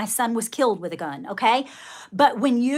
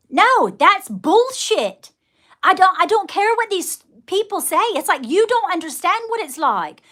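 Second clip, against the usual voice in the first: enraged rant; 7 semitones higher, 4 dB louder than usual. Real, not acted. Rejecting guru advice to her viewers, angry and loud. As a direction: spit out the dismissal. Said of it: "that's what enrages me".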